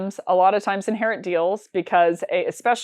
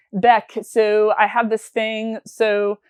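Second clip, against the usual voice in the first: slow, mock French accent, pitched a bit higher; 3 semitones higher, 3 dB louder, slower than usual. French colleague telling Beck about a client, slow French accent. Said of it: "stop with the French accent".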